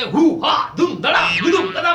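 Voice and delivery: high mock karate grunts and thuds; martial arts cries. Kids barging into the train, playful mock fight cries. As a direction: bash out the fight noises like a cartoon.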